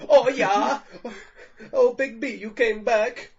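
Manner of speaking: high, loud delighted cheer; excited. Real, not acted. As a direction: greet him with glee. Greeting returning character.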